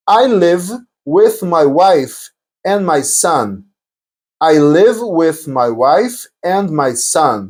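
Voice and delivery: very slow, measured example for learners; slow model sentence. Shown as a demonstration. Teacher demonstrating a married person's answer, very slowly. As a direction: space out each phrase to be copied.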